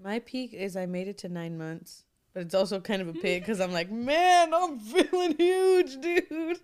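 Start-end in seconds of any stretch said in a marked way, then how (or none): 0.0-2.0 soft whisper; breathy whispered aside. Real, not acted. Unvoiced, whispered line. 3.9-6.6 high, slow, drawn-out complaint; whiny high voice. Retold or imitated. Pregnant speaker voicing herself.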